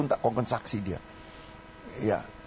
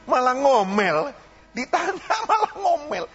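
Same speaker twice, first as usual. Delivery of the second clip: high laughing exclaim, pitched way up; laughing disbelief; 11 semitones higher, 3 dB louder than usual. Real, not acted. Preacher to congregation about a grumbling member, laughing high.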